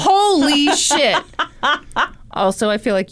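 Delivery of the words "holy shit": shocked. Retold or imitated. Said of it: "I was like".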